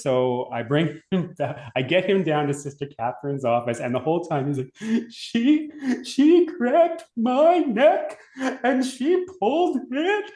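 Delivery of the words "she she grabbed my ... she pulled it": high, wailing sob-voice of a little brother; imitating blubbering kid. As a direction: mimic a bawling kid, pitched way up and shouting the end.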